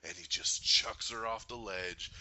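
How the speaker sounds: normal talking voice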